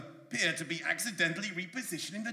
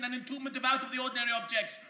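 ordinary talk

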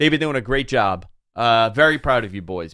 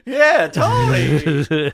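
drawn-out shout; 4 semitones higher, 4 dB louder, much slower than usual. Acted. Mock agreement after the insult.

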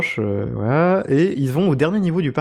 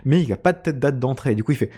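usual speaking voice

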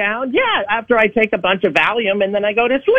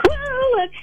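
a high squeak, drawn out; squeaky slow voice; 4 dB quieter than usual. Acted. A caller pondering the question in a slow squeak. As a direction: squeak it like a cartoon character pondering.

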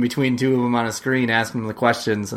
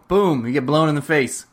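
normal talking voice

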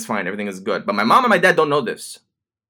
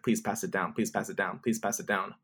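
soft, low repeated murmur; understated imitation of a professor; retold handout instruction; 4 semitones lower, 11 dB quieter than usual. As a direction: say it quietly, like a routine instruction. Imitates professor handing out papers.

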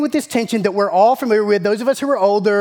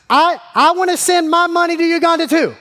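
higher-pitched imitation of a young daughter; earnest child; 8 semitones higher, 3 dB louder than usual. Daughter telling dad her new plan, earnest child. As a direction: announce it high, like an earnest kid. Acts daughter announcing changed mind.

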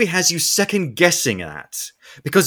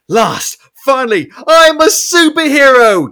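high, loud joyful shout; triumphant cry; 8 semitones higher, 7 dB louder than usual. Retold or imitated. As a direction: burst out like a dream finally come true. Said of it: "now he's like".

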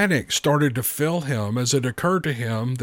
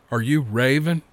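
ordinary delivery

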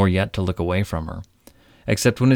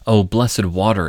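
louder cry of longing, "Oh, blessed water!"; a louder, yearning exclamation; 6 dB louder than usual. Acted. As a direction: cry out for water like a parched king. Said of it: "exclaimed Midas".

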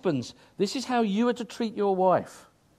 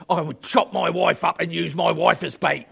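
retold bloke's remark; 5 dB louder than usual. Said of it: "a bloke came along and said".

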